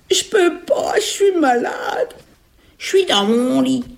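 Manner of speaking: high, drawn-out whining granny; fake sick grandmother. Ogress feigning illness as grandmother, high whiny voice. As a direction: whine as if ill in bed.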